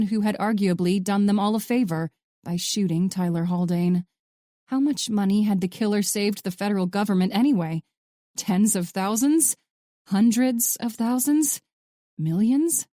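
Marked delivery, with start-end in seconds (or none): none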